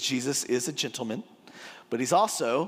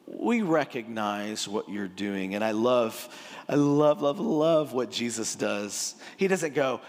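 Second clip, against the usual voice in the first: ordinary talk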